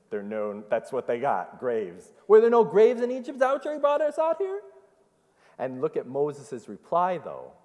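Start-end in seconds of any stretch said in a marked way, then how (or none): 2.3-4.6 high, loud, fast whiny sarcasm; mock sarcasm. Preacher voicing Israelites mocking Moses, high and whiny.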